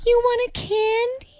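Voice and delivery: high, sweet new mom voice; baby talk. Retold or imitated. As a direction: coo it to a little one. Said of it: "Back in the day, I was like".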